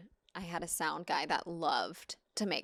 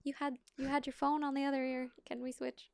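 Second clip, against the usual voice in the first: high, softening request to an actor; tactful, light, lifted voice; gentle correction; 7 semitones higher, 3 dB quieter, faster than usual. Acted. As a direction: ask as gently as possible, quick then hushed. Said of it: "I do it as gently as possible".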